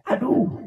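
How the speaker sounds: aduh tinggi yang tiba-tiba; teriakan kesakitan. Retold or imitated. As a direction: jerit kecil karena sakit. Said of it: "dia teriak". ("Aduh" diteriakkan tinggi karena kesakitan)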